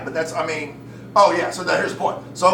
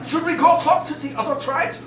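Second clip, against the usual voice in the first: put-on high-pitched German accent; hammed-up German tribesman; German tribe member; 13 semitones higher, 3 dB louder than usual. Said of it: "it was German tribes they were talking about".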